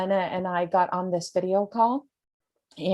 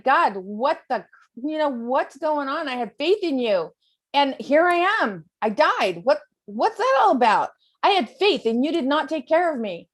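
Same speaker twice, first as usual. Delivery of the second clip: upset man to God; 6 semitones higher than usual. Retold or imitated. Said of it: "he's like".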